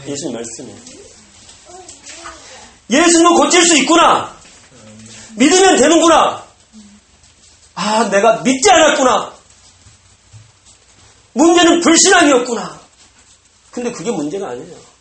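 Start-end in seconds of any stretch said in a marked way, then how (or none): 2.9-9.4 sudden realization. Acted. 11.3-12.8 sudden realization. Acted. Father's realization.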